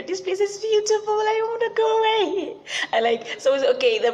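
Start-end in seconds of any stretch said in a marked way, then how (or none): 0.0-2.6 much higher, louder, dreamy gushing; delighted squeal. Pretend. Speaker imagining the mountaintop view, gushing in a high squeal. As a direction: gush like you never want to leave.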